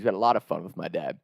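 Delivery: ordinary delivery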